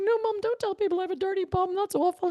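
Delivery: put-on high, fast, whiny child voice; pleading small child. Little girl begging her mum not to tell, high and whiny. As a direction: beg like a little kid. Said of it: "And I'm like".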